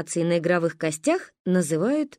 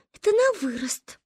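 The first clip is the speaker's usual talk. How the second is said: put-on much higher, little girl's grave tone; self-important; 13 semitones higher than usual. Manka explains her bra, high and very important. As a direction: say it with great dignity. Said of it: "важно сказала Манька".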